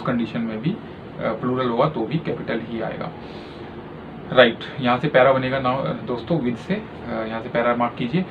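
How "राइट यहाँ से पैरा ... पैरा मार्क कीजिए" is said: usual speaking voice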